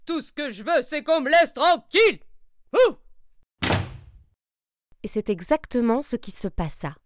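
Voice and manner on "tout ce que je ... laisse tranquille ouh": loud, higher angry bear shout; furious bear roaring. Acted. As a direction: leave me alone, roared. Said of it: "hurla monsieur Ours".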